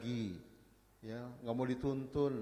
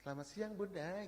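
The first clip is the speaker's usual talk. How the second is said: high, camp salon greeting; effeminate hairstylist; 7 semitones higher, 3 dB quieter than usual. Retold or imitated. Male hairstylist greeting women customers, high effeminate impression. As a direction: greet in a put-on effeminate lilt. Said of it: "jadi melambai kan".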